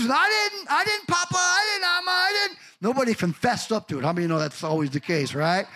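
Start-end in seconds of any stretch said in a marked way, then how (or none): 0.0-2.6 put-on high, whiny little-kid voice; kids denying it. Grandkids whining denials to their grandpa. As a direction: squeak out the excuses like guilty grandkids.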